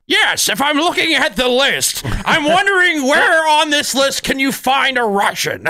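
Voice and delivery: high, strained yelling comedian voice; Gilbert Gottfried. Acted. Host doing a listener's favorite impression, yelling comedian. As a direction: yell every word in a high voice. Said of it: "Gilbert Gottfried".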